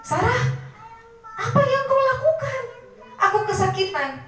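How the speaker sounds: high, strained cries of pain; pained yelling king. Acted. Paralysed king crying out to Sarah, high pained yell. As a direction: cry out to Sarah in agony. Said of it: "Langsung teriak-teriak".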